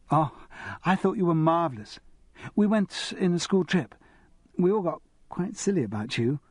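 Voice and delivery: a hint of a bright young girl, a little fast; lightly voiced eager schoolgirl. A narrator's reading. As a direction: nudge the voice up into an excited teenager. Narrator voicing young Felicity.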